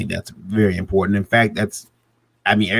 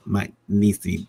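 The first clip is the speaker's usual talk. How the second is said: usual speaking voice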